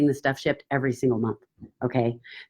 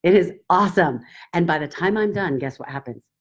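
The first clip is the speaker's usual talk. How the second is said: ordinary talk, nothing marked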